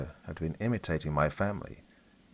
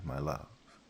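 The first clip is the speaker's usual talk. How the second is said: "my love" dropped low and soft, mocking; dark, mocking; 4 semitones lower, 8 dB quieter than usual. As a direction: twist "my love" into a cold taunt. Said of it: "Her tone became much darker".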